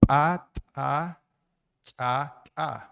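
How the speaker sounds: "cha" said with a glottal catch, like a clicked "ch"; ejective sounds demonstrated. Shown as a demonstration. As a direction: push out "a" and "cha" as glottalized consonants. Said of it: "se pronuncia". (demostración de consonantes eyectivas)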